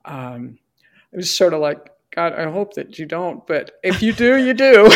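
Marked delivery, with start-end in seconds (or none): 2.1-4.9 laughing prayer. Retold or imitated. Praying aloud, resigned laugh.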